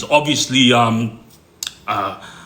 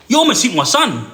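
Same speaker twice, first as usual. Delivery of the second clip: higher, indignant retelling of an accusation; retold angry confrontation; 6 semitones higher than usual. Brother to driver who almost hit his son, retold indignation. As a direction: confront them, as a storyteller would.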